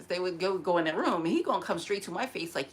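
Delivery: ordinary delivery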